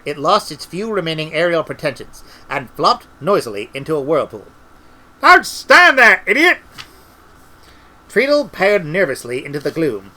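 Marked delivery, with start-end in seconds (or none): 5.2-6.6 exasperated shout. A narrator's reading. Fallen wizard shouts at Treatle.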